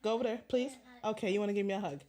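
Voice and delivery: ordinary talk, nothing marked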